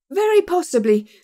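high, loud, forced cheer; a desperate show of good humour; ghastly eager cry. A narrator's reading. Nervous manager forcing cheer at visitors, high cry. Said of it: "cried Mister Pinner, with a ghastly smile".

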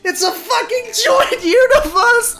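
high, crying-out exclamation; tearful excitement. Real, not acted. Fan realising it's a joined universe, tearfully thrilled. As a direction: burst out, voice full of happy tears.

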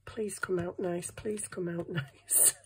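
hushed hopeful whisper, slowing down; whispered wish with a little laugh; whispered plea. Real, not acted. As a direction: breathe it like a wish. Hoping a print comes out.

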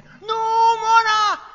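very high, very loud drawn-out cry; desperate scream. Retold or imitated. Mother screaming as Mona jumps from the window, desperate scream. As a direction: scream her name as she falls.

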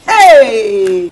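loud, drawn-out outraged exclamation; indignant cry. Acted. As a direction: let out a long scandalised yell. Outraged at small money offer.

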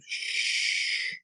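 sharp whispered hush; shushing. A narrator's reading. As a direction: hush him urgently. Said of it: "Tom seized his comrade's arm and said".

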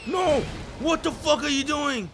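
loud, high, desperate "no"; real furious yell. Real, not acted. Streamer yelling at an enemy attacking him, furious loud protest. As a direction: shout at the screen in disbelief. Losing again to enemies.

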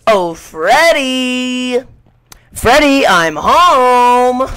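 drawn-out teasing call; sing-song, hammed-up homecoming call; mock sing-song. Gamer teasing Freddy with a mock homecoming call.

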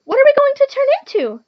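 very high, loud question; eager pollywog. A narrator's reading. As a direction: ask it as a bursting-with-curiosity pollywog. Pollywog eagerly asks the caterpillar.

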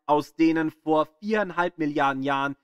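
ordinary delivery

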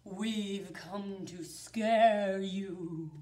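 slow low spooky creature; spooky pumpkin voice. Acted. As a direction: drag it out like a ghoul. Pumpkin head speaks.

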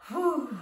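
loud exaggerated phew of fullness; hammed-up whew after a huge meal; big puffed whew. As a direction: blow out a stuffed, satisfied whew. After eating everything.

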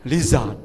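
"listen" slipping into "lizard"; mispronounced listen. Shown as a demonstration. Teacher joking about bad pronunciation, "lizard" for "listen". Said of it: "เดี๋ยวกลายเป็น lizard".

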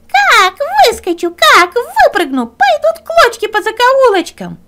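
high, loud threatening fox; menacing fox. Acted. Fox shouts threats from the stove, high and loud.

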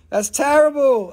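playful mock outrage. Shown as a demonstration. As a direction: fake horror at "terrible". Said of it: "Ugh, that's terrible".

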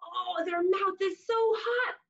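ordinary delivery, nothing marked

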